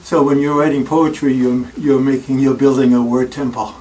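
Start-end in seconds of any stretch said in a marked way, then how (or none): none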